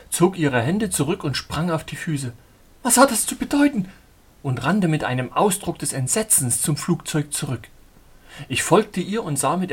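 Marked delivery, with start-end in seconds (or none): 2.8-3.9 much higher, louder alarmed question; alarmed heroine. Acted. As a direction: cry it in sudden dread.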